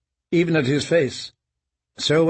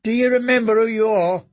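put-on probing boy; 8 semitones higher than usual. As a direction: press him to remember. Boy questions prisoner.

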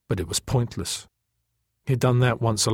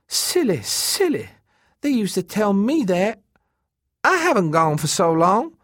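high-pitched, swooping female character; Elena's coaxing voice; 9 semitones higher, 3 dB louder than usual. A narrator's reading. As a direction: wheedle him in a high voice. Elena coaxes Holt in story.

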